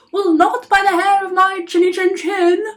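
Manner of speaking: high, loud squeaky little pig; second pig calls through the door, high and loud. Acted. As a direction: call it out high and defiant.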